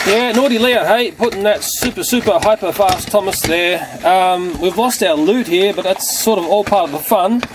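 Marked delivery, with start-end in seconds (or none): none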